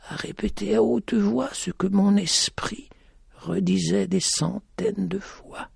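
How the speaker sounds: high, soft, slow and hushed; blind woman confiding. A narrator's reading. Blind lady admits her inner struggle, high and hushed. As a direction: let it fade almost to nothing as she confesses.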